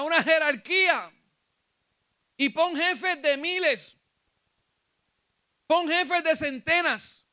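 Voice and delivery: loud-edged, high-pitched emphatic counsel; Jethro admonishing Moses. Acted. Jethro warns Moses he will wear himself out, urgent admonishing. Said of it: "Jetro le dijo".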